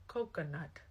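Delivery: soft, low, hushed single word; understated mock awe; hushed reveal. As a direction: drop to a quiet, mock-solemn naming. Imitates the man's coconut line again.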